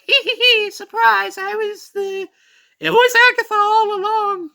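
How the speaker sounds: very high, loud falsetto with a giggle; hammed-up high villain woman; mock villain reveal. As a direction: cackle like a cartoon villainess.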